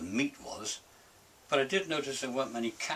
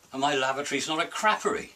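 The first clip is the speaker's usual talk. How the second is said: normal talking voice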